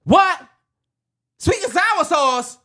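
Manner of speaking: loud, high, slow shocked question; mock surprise. Mimicking a Wendy's regular, loud high mock surprise. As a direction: gasp it out in mock disbelief.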